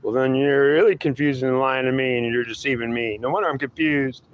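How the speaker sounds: high, pleading whine of a lost believer; whiny seeker voice. Acted. Seeker whining back at his God, high and whiny. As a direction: whine it up high like a fed-up crybaby.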